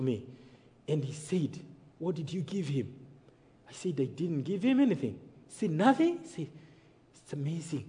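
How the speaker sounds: low, soft storytelling with long pauses; quiet, measured preacher's recollection; hushed testimony. Real, not acted. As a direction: drop right down and let each phrase settle. Hushed telling of his release.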